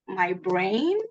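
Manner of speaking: normal talking voice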